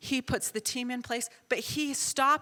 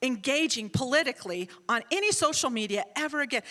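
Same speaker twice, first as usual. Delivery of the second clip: really serious; 3 dB louder than usual. Retold or imitated. Son warning mother on the phone, stern and deliberate. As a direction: warn sternly, stretching the opening words. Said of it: "like, really serious".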